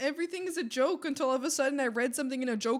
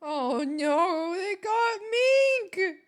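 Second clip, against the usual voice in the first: high, drawn-out wail of dismay; real distress at the loss; slow, high cry of dismay; upset cry; 7 semitones higher, 6 dB louder, much slower than usual. Real, not acted. Streamer upset over a lost character.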